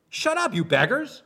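high, loud snap at the beggars; angry rebuke. Pretend. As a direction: bark it at them with contempt.

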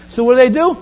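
usual speaking voice

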